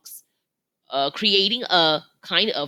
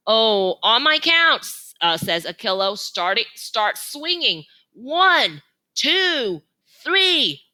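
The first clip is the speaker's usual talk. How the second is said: counting elephant leader; 6 semitones higher, 4 dB louder, slower than usual. A narrator's reading.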